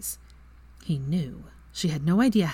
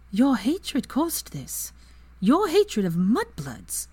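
slow, higher, cutting accusation; accusing; 7 semitones higher, slower than usual. Acted. Hermione accusing Lucius of hatred, slow and cutting. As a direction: lay each word on him slowly.

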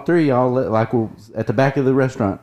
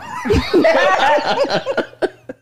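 high burst of laughter. Real, not acted. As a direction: break into a shrieking laugh. Joke about Ta Yang Yang.

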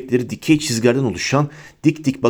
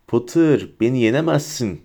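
ordinary delivery, nothing marked

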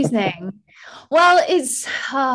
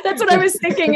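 laughing through the words; real laughter spilling into the reply; 10 semitones higher, 3 dB louder, faster than usual. Real, not acted. Host laughing back at the guest's quip, laughing.

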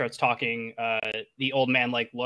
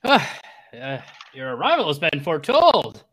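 put-on loud, swooping old sage voice; old hermit; 2 semitones higher, 5 dB louder than usual. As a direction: proclaim a prophecy as an old hermit. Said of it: "so again, he goes".

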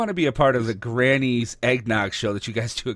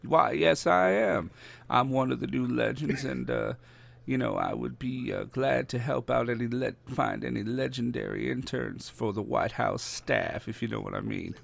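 folksy ex-president, dropping softer as he goes; Bill Clinton; 5 dB quieter than usual. Acted. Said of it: "is Bill Clinton one of the legends?".

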